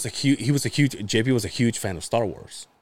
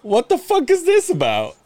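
shocked sister; 13 semitones higher, 4 dB louder than usual. Retold or imitated. Sister's reaction retold.

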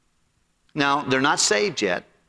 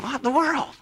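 baffled; 11 semitones higher than usual. Retold or imitated. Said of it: "You're thinking".

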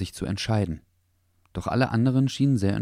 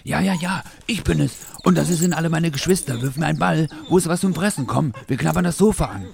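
high, loud, hyper little dog voice; overexcited puppy; 9 semitones higher, 5 dB louder than usual. A narrator's reading. Little dog showing off his siblings, overexcited barking voice. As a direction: yap it out, wildly worked up. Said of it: "bellte der kleine Hund überdreht".

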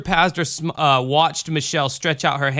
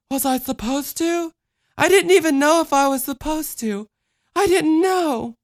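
put-on high-pitched little-girl protest; small child, anxious and defensive; confused child. Voices the child.

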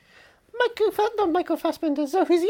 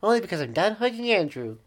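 low character voice; 7 semitones lower than usual. Acted.

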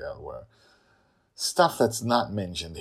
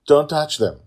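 loud, higher urgent warning; 10 semitones higher, 14 dB louder than usual. Acted. Father warning the girl off shards, loud. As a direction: warn her off sharply.

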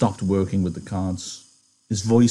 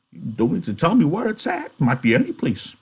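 put-on much higher, quavering old man; old Candy; 6 semitones higher than usual. Candy asking George about the farm, old and hopeful.

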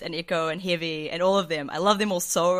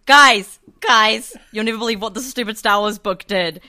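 loud, higher retold call that eases off; calling out to flatmates; 3 semitones higher, 11 dB louder than usual. Reader running to flatmates about a stupid book, calling out. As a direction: holler for the housemates, then rant. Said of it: "you can hear me going".

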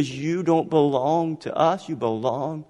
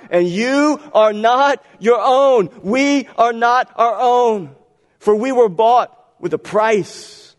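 loud, slow, high preaching climax; slow, ringing declaration to the congregation; forceful preaching; 7 semitones higher, 4 dB louder, slower than usual. Acted. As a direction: hammer each word home from the pulpit.

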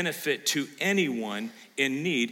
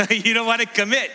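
high, loud, laughing jab at the crowd; laughing tease; 9 semitones higher, 8 dB louder than usual. Real, not acted. Pastor laughingly teasing congregation who won't raise hands, loud and high. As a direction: tease them through a laugh.

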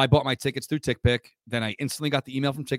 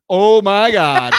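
much louder, slow shock; shocked oh my God; 4 semitones higher, 12 dB louder, much slower than usual. Real, not acted. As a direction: gasp at the price. Shocked by ticket prices.

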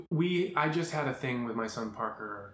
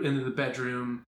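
ordinary delivery

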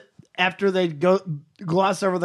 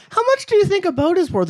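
high naive voice; 11 semitones higher than usual. Retold or imitated. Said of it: "And she's like".

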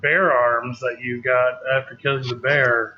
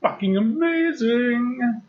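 drawn-out excited; 8 semitones higher, much slower than usual. Real, not acted. Drawn-out enthusiastic reply.

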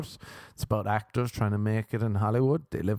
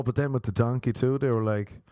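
ordinary delivery

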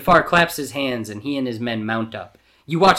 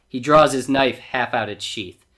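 ordinary delivery

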